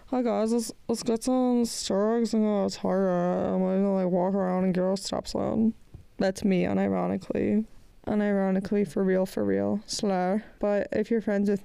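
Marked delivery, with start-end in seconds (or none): 0.1-5.7 a touch of put-on vlogger voice; lightly mocked vlogger. Inviting viewers to Starbucks and Target, lightly mocked vlogger voice. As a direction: lightly parody an outing announcement. Mock influencer Target trip, then "that's me.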